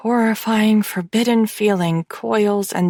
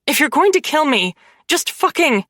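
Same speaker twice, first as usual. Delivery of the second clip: desperate plea; 6 semitones higher than usual. Acted. Captive pleading with her would-be killer, frantic. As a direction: beg him to end it fast.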